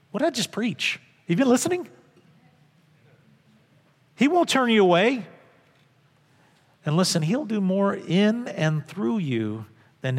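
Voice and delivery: high, loud preaching at full force; fiery preaching. Acted. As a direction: preach it out with all your fire.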